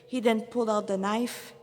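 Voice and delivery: ordinary delivery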